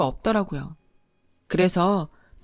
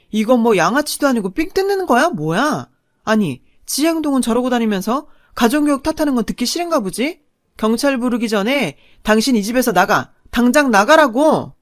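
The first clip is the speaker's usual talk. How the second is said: angry telling-off; 3 semitones higher, 5 dB louder than usual. Retold or imitated. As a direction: throw her out of the house. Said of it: "제가 한 소리 했죠".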